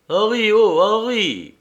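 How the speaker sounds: high, loud call to wake someone. Acted. Bill calls Henri to wake him, calling out. Bill wakes his sleeping partner.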